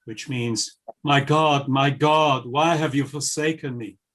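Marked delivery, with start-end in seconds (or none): none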